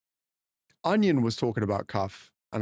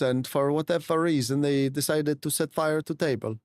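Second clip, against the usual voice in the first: put-on Swedish accent in casual chat. Streamer answering chat as a Swede, Swedish accent. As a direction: keep up the sing-song Swedish English. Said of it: "this is such good Swedish accent".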